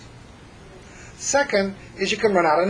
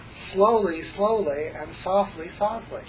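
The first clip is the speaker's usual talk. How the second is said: slow and soft; 3 semitones higher, slower than usual. Shown as a demonstration. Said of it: "So we say".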